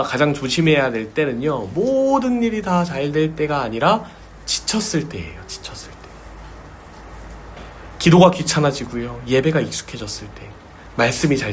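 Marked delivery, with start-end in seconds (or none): 5.5-6.0 breathy whispered repeat. Acted. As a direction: drop to a whisper for weight. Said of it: "repeated, whispered". (속삭이듯 반복)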